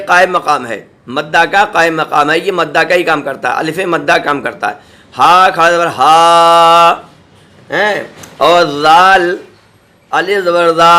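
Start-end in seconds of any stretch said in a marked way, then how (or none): 5.2-7.0 ہا کھڑا زبر ہا spelled very slowly with long a; long madd spelling; stretched madd. Shown as a demonstration. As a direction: draw out each ہا. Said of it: "تو یہ پڑھا جائے گا ہا کھڑا ہا".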